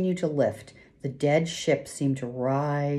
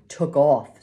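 ordinary delivery

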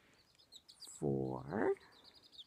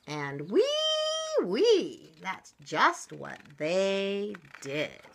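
lively, loud storybook reading with big pitch swings; story read-aloud; 4 semitones higher, 8 dB louder, slower than usual. A narrator's reading. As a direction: read it big and animated for small children. Reads a picture book to children.

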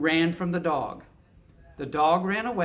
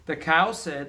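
usual speaking voice

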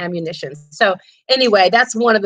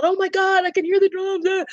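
mock panic; 9 semitones higher than usual. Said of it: "So they're freaking out".

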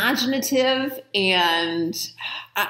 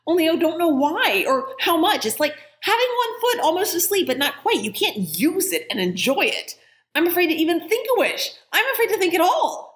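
put-on higher, fretful young girl voice; worried girl Jane; 9 semitones higher than usual. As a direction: let the girl's worry spill out, higher. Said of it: "Not very clearly".

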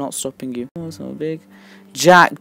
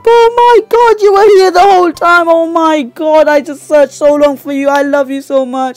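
very high, loud disbelieving cries; overjoyed screaming; 12 semitones higher, 8 dB louder than usual. Real, not acted. Player finding what they searched so long for, overjoyed scream. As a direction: scream it high, stretching the words, then ease slightly.

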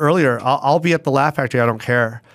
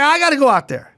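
high-pitched retold protest; indignant complaint; 12 semitones higher than usual. Host who must follow a hot opener, indignant complaint. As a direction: plead the case in mock exasperation.

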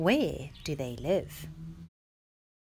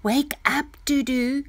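higher, louder calling voice; mother waking child; 8 semitones higher, 7 dB louder than usual. A narrator's reading. Mother waking Dudu from her dream, calling out loudly. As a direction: call out to rouse a sleeper. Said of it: "said her mother".

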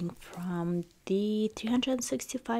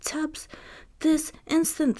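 wicked stepmother crying out; 8 semitones higher than usual. Acted. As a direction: scold them like a stern stepmother. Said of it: "stepmother cried".

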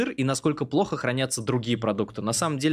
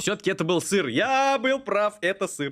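triumphant; 6 semitones higher than usual. Real, not acted. Triumphant guess was right.

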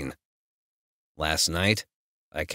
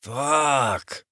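roar; 7 semitones higher than usual. Acted. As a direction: let it burst out in frustration. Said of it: "I roar".

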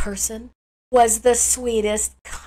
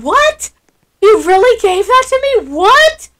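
stunned player; 9 semitones higher, 5 dB louder than usual. Retold or imitated. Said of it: "He's saying".

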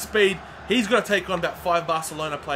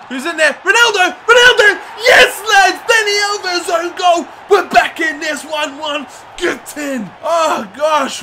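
much higher, much louder ecstatic shouting; goal celebration roar; 9 semitones higher, 10 dB louder than usual. Real, not acted. Gamer celebrating the equaliser at Old Trafford, roaring. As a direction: scream it like you just scored in a final.

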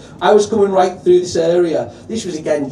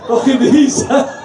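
higher, louder mock heckle; imitating a Scouse lad calling out from the crowd; lightly acted Scouse heckle; Scouser heckler; 5 semitones higher, 3 dB louder, slower than usual. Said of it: "some Scouser goes".

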